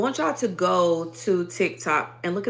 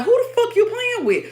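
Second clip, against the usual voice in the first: much higher, defiant, in-your-face; menacing challenge; 8 dB louder than usual. Acted. Ancestors warning off an attacker, defiant and menacing. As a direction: spit it at someone picking a fight.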